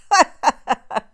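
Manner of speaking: a big loud burst of laughter. Real, not acted. Laughs after ain't nothing wrong.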